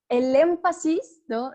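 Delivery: ordinary talk, nothing marked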